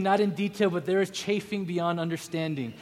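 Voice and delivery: usual speaking voice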